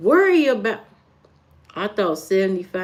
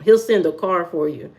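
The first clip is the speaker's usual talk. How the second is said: ordinary talk, nothing marked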